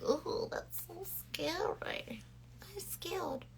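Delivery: high, slow, hushed mock fear; mock scared whisper. Everybody reacting to the dark bronzer, mock scared whisper. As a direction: whisper it in pretend fright.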